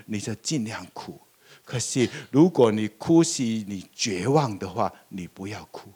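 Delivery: soft, low voice of Jesus consoling the widow; tender comfort. Acted. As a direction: speak gently to a grieving mother. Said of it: "耶稣一定跟他说". (耶稣对丧子寡妇温柔地安慰)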